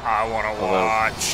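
low creepy voice. Pretend.

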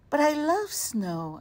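put-on high, eager little girl protest; insisting girl. A narrator's reading. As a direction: plead like a stubborn child. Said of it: "Irene insisted".

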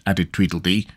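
plain reading voice

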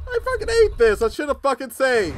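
frustrated. Real, not acted. Frustrated after dying.

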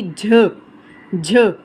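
झ said twice with a breathy release; aspirated jh. Shown as a demonstration. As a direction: breathe out after the j in झ. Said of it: "इसकी भारी आवाज़ बनेगी". (झ को हवा के साथ दो बार)

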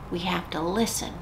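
soft hushed voice for the puppet; hushed, secretive puppet aside; quiet puppet voice. Acted. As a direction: lean in and say it softly. Librarian voicing puppet's whisper.

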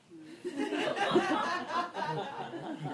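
bursts into a high laugh; big high laugh. Real, not acted. Amused teacher laughing at his own question.

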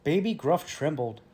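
ordinary delivery

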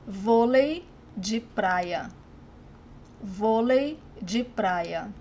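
Vôlei de praia said slowly, syllable by syllable; slow it down so learners can copy; slow model word. Shown as a demonstration. Teacher slowly models beach volleyball for learners, slowly. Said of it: "teacher modelling sport names".

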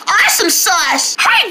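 usual speaking voice

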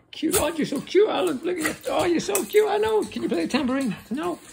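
very high, loud cooing pet voice. Real, not acted. Owner fussing over his dog Alan, high cooing baby talk.

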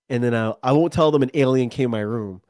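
ordinary talk

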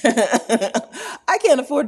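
slow, laughing and high. Real, not acted. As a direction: giggle through the words. Laughing at own joke.

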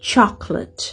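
"chocolate" in two syllables, CHOK-lit; "chocolate" with reduced second syllable; English chocolate. Shown as a demonstration. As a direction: say "chocolate" alone, middle vowel dropped. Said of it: "ออกเสียงเป็นคำเดียวๆได้แล้ว".

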